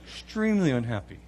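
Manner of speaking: ordinary talk, nothing marked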